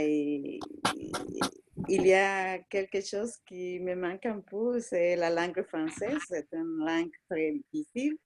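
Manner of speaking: real Latin American accent in French; French with a Spanish-speaking accent; foreign accent in french. Real, not acted. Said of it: "ton accent est merveilleux".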